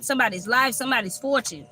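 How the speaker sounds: usual speaking voice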